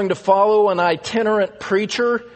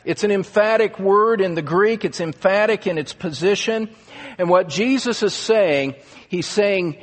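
usual speaking voice

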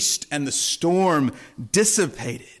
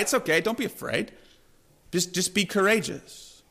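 mock trite reassurance; 5 dB quieter than usual. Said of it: "almost trite words".

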